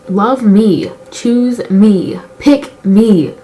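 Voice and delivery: loud plea in short separate bursts; emphatic plea. Acted. Vlogger begging to be put first, emphatic plea. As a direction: plead loudly, one demand at a time. Pleading to be chosen.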